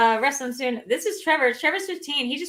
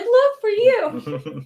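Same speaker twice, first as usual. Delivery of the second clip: slow high quote; 11 semitones higher, 4 dB louder, much slower than usual. Retold or imitated. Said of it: "you looked at me and you're like".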